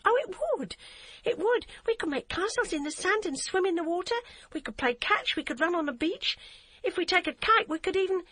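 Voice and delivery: put-on a girl begging a friend to come along; begging little girl. A narrator's reading. Child voice of Hadia.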